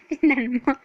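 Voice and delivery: loud laughing finalement. Real, not acted. Player repeating finalement, laughing. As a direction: laugh the word out.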